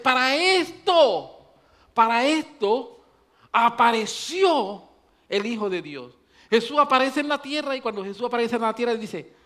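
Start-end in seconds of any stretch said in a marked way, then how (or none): 0.0-6.1 loud, high preacher's build-up; preaching at full force. Acted. Preacher warning the congregation, loud and high. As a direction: drive the warning home to the church.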